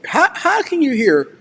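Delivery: sudden high disbelieving how; surprised. Real, not acted. To chat claiming they hear he slept, surprised. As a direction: jump up in pitch, baffled anyone could tell. Chat says he sounds sleepy.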